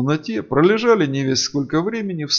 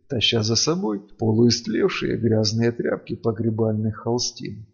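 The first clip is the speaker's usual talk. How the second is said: ordinary delivery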